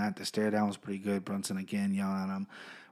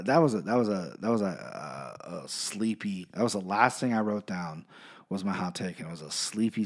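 ordinary talk, nothing marked

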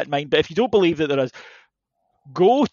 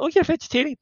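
woman on the date; 7 semitones higher, faster than usual. Retold or imitated. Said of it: "she's like".

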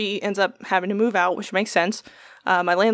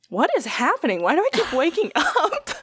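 bewildered; 9 semitones higher than usual. Retold or imitated. Confused waking at night.

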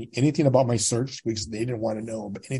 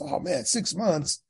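high, soft and slow, deflated; dismayed groan; 9 semitones higher, 5 dB quieter, slower than usual. Retold or imitated. To the clerk about a six-month wait, slow deflated dismay. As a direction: let the six months sink in with a sigh.

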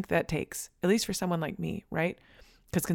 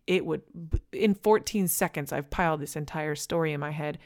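normal talking voice

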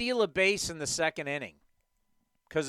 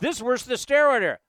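mocking quote; 7 semitones higher, 4 dB louder, faster than usual. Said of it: "honestly tried to say".

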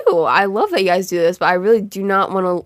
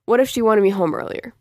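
normal talking voice

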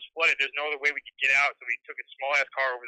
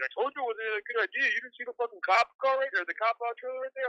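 buddy scolding; 8 semitones higher than usual. Retold or imitated. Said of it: "my buddy's like".

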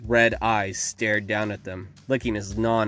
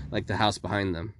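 usual speaking voice

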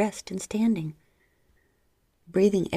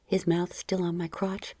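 ordinary delivery

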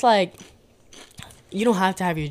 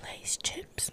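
hushed, voiceless demo; whisper; 14 dB quieter than usual. Shown as a demonstration. Guest shows why whispering would aggravate her, whispered. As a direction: whisper it under your breath. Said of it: "'Cause if I whisper".

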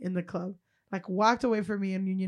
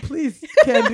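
high, loud, laughing mom plea; mock mom; 6 semitones higher, 9 dB louder than usual. Host playing the co-host's mom, pleading and laughing. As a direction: ham up a begging mother. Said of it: "And your mom's like".

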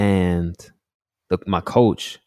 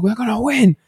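dad insisting. Retold or imitated. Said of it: "He's like".

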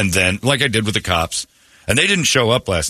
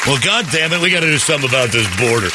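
higher, fast grumbling outburst; mock angry rant; 5 semitones higher, faster than usual. Car owner blaming the border, fast mock grumble. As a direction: rant like a cranky old man.

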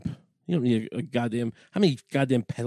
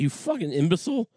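normal talking voice

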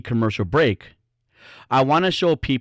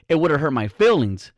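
ordinary talk, nothing marked

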